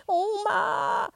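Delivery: put-on a wailing child's scream; high crying shout. Boy screams for grandma, crying and high. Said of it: "schreit er nochmal in den höchsten Tönen".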